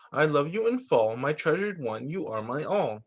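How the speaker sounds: usual speaking voice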